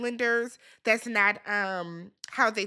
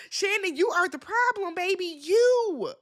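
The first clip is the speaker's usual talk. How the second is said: high, incredulous call-out; amused exasperation, pitched high; exasperated scolding; 8 semitones higher, 3 dB louder than usual. Real, not acted. Mock scolding Shannon right after laughing.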